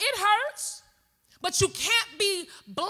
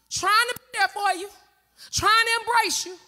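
ordinary delivery

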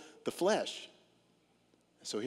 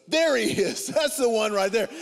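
higher, louder mock accusation; accusing passenger; 7 semitones higher, 7 dB louder than usual. Passenger pointing the preacher out to the flight attendant, loud mock accusing. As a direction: point him out like a tattling kid, hammed up. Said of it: "points at me and goes".

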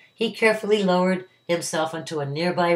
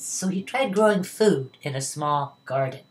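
plain reading voice

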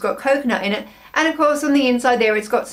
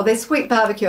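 normal talking voice

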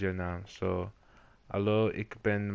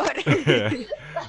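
high laugh; 13 semitones higher, 5 dB louder than usual. Real, not acted. Mark laughs at himself.

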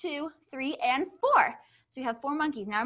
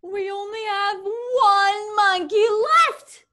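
dismayed; 5 semitones higher, 8 dB louder, slower than usual. Pretend. Mock dismay at last monkey.

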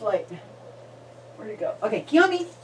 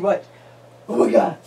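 ordinary talk

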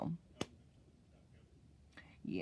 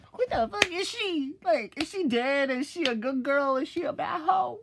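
much higher and louder, sassy; mock ditzy voice; 7 dB louder than usual. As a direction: play a shrill, sassy teenager. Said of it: "I'm like".

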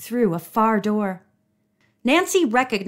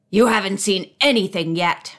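menacing angry retort; firing back. A narrator's reading. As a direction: fire the threat back at her. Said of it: "he fired at her".

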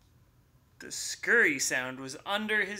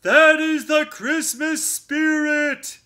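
loud, slow, higher bellow; bear bellowing; 11 semitones higher, 13 dB louder, slower than usual. Acted. Bear bellowing at Mouse, loud and slow. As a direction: boom it out with glee. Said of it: "bellowed the bear".

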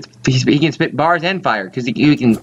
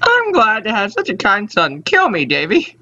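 put-on higher old-lady voice at the start; lightly voiced old mother; 7 semitones higher than usual. Mother thanking her kind son, lightly voiced old lady.

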